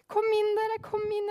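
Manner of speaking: high, loud shout of welcome; eager shout. A narrator's reading. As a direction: fling it out the door to her. Martin calls them inside. (Martin roper inn den frosne kvinnen, høyt og ivrig)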